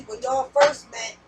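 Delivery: normal talking voice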